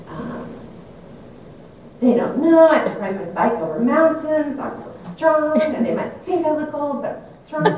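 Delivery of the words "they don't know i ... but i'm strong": defiant inner voice. Retold or imitated. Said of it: "my mind was just like".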